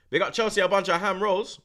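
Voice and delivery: high, loud mocking call-out; loud shout-out. Real, not acted. Shouting out Chelsea fans in chat, loud and mocking. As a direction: yell it across the room.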